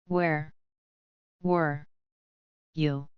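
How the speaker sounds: each word slow and spaced apart; deliberate pause after every word. A narrator's reading. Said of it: "slower this time".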